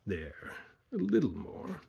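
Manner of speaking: slow, breathy cruel whisper. A narrator's reading. Pouring acid, cruel whisper.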